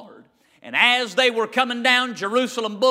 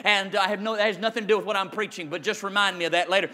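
quick aside; 3 semitones lower, 4 dB quieter, much faster than usual. Real, not acted. Fast quiet joking aside.

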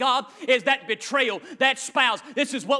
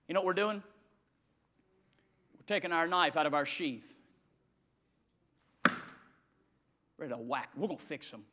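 menacing hush; 7 semitones lower, 9 dB quieter, faster than usual. Acted. Menacing aside to congregation.

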